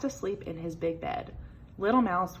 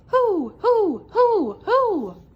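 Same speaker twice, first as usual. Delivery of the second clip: high, loud spooky owl hoo; owl hooting; 13 semitones higher, 10 dB louder, much slower than usual. A narrator's reading. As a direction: hoot like an owl in the night. Mouse imitating owl.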